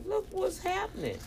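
"Look what's happening" sent way up high; high-pitched repeat. Real, not acted. Repeats the phrase for emphasis.

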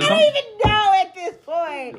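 high, almost crying with laughter; helpless laughing wail. Real, not acted. Host who lost her own question, helpless laughing wail.

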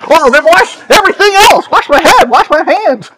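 high, fast, eager plea; eager Peter. Retold or imitated. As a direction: Peter gushing to be washed.

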